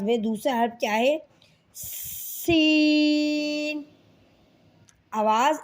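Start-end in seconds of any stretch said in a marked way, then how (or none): 1.7-3.8 سین with a long sharp hiss; hissing seen. Shown as a demonstration. Teacher drawing out a hissing سین. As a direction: stretch the سین with a whistle. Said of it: "سین کو ہم نے سختی اور سیٹی کے ساتھ پڑھنا ہے".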